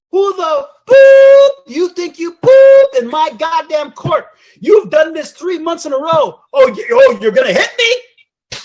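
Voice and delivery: high, loud, drawn-out acted tirade; furious judge yelling. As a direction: roar it as the enraged judge. Acts out judge yelling in corridor.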